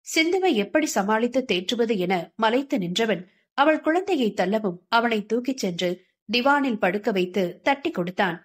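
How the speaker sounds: ordinary delivery, nothing marked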